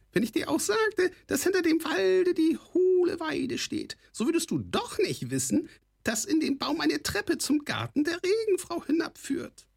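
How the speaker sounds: very high goblin voice full of scorn; a scornful goblin's squawk; sneering kobold rasp. A narrator's reading. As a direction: talk down to him like a mocking goblin. Kobold continues teasing.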